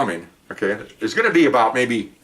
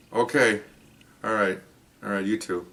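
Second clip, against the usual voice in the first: softer, sinking sign-off; retold deflation; 3 semitones lower, 6 dB quieter than usual. Officer ends the bad phone call, imitated quiet deflation. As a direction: imitate a man quietly giving up.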